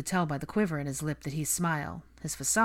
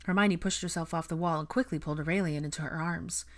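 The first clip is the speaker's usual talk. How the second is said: plain reading voice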